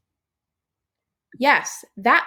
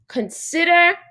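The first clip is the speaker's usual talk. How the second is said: spelled out slowly like a warning sign; slow, emphatic warning-sign read; slower than usual. Shown as a demonstration. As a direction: read it like a posted caution sign, deliberately. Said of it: "our sign should say".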